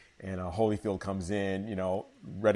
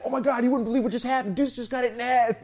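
higher and faster, breathless news; excited co-host; 5 dB louder than usual. Retold or imitated. Co-host Jamie blurting the news on air, excited and fast. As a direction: rush it out, can't believe it. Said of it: "And Jamie's like".

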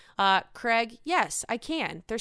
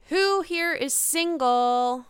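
quoting Hannah; 5 semitones higher, slower than usual. Retold or imitated. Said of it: "Hannah says to everyone".

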